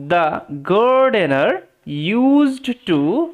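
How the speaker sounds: very slow, drawn-out words with gaps; careful word-by-word reading. Shown as a demonstration. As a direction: model each word slowly for learners. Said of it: "একদম ধরে ধরে পড়ার চেষ্টা করব". (ধরে ধরে ধীরে পড়া)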